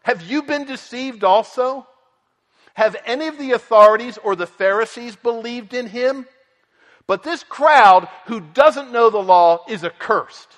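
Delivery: high, loud indignant Pharisee, slowing on the insults; a contemptuous religious leader scolding his officers, loud and high; scornful outrage. Retold or imitated. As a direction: sneer down at the temple police in disbelief. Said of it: "And the response was".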